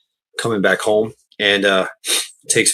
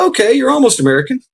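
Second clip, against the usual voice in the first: very high, fast tease; teasing approval; faster than usual. Retold or imitated. American ribbing the Brit, high fast. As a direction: tease him playfully. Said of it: "It's like".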